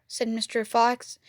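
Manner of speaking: ordinary delivery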